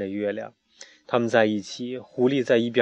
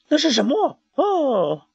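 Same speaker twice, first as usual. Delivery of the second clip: startled wonder; 13 semitones higher, 5 dB louder, slower than usual. A narrator's reading. Sudden wonder at eggs.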